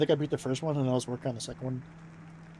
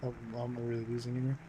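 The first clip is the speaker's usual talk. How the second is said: ordinary talk, nothing marked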